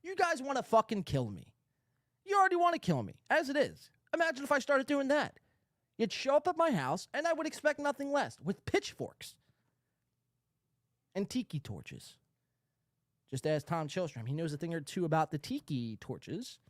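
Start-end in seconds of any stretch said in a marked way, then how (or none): 2.2-12.1 high, loud indignant rant; worked-up rant. Real, not acted. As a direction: whip yourself up over the outrage. Exaggerated rant.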